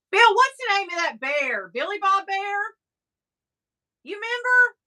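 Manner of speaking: high-pitched call to someone out of the room. Real, not acted. Host calls Bill outside the room about a bear's name, calling out.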